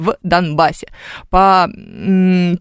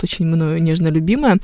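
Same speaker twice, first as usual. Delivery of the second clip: ordinary talk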